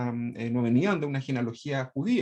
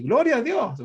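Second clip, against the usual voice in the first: congregation praise; 7 semitones higher, 5 dB louder than usual. Retold or imitated. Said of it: "dice alguno por ahí".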